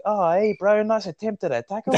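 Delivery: high-pitched mock encouragement; over-nice Island boy. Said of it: "they're the nicest blokes in the world".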